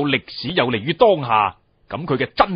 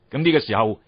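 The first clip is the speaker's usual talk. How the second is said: ordinary talk, nothing marked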